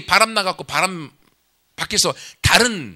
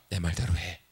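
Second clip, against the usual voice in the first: much lower, softer, settled command; firm God voice; 11 semitones lower, 9 dB quieter than usual. Acted. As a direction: close the argument in a low, quiet voice. God answers Hosea firmly. (낮고 조용하게 단호한 하나님의 말투)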